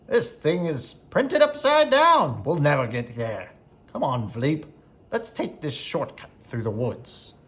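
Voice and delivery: put-on a flustered old man squinting at his map; absent-minded exclaim. A narrator's reading. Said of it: "Maurice pulled out his map and exclaimed".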